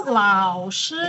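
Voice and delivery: "lao" dipping low, "shi" level; third then first tone. Shown as a demonstration. Teacher giving the word for teacher, careful tones on "lao shi". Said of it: "Launya nada tiga".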